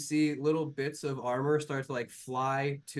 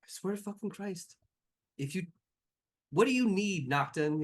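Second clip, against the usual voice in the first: exasperated threat; 5 semitones higher than usual. Acted. GM voices exasperated NPC.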